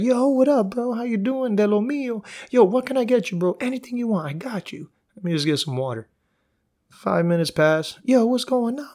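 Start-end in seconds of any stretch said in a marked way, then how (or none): 0.0-6.1 high, chummy waiter voice, raised; eager waiter. Retold or imitated. Narrator mocking a resort waiter, high and chummy. 8.0-8.9 high, chummy waiter voice, raised; eager waiter. Retold or imitated. Narrator mocking a resort waiter, high and chummy.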